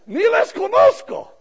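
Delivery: high, loud, slow mock indignation with a laugh; mock annoyed. Pastor mocking his own annoyance at the pastors, mock indignant. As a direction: squeak it out, pretending offence.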